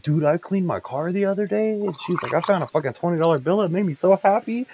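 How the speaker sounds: high, bright mock voice; happy coworker. [name] imitates Veronica finding his hidden money, high happy voice.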